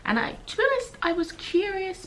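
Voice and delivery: high, light, playful. Real, not acted. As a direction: lift it up playfully. Lively aside about the book.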